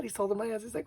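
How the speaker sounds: ordinary talk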